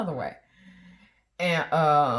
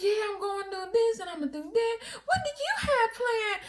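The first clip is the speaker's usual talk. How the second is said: much higher, chirpy chatter; flirty teller; 13 semitones higher than usual. Retold or imitated. Wife mimics teller telling husband her plans, much higher. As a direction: gush about her plans. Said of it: "she's like".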